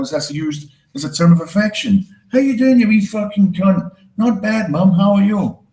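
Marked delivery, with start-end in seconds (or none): 2.3-3.9 affectionate Scottish greeting. Retold or imitated. 4.2-5.6 cheery reply. Retold or imitated. Child replying to Scottish mum.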